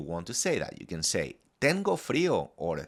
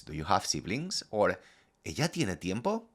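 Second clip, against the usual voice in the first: ordinary talk, nothing marked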